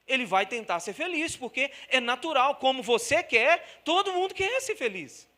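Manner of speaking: vai ficando mais alto e agudo; crescendo. Real, not acted. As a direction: cresce até o clímax. Crescendo do sermão. (pregação em crescendo)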